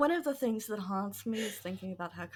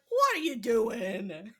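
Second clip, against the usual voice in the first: incredulous; 2 semitones higher, slower than usual. Retold or imitated. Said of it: "She'd be like".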